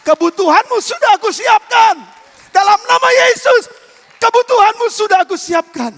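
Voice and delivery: shouted proclamation. Acted. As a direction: proclaim it over the congregation. Preacher shouting declaration.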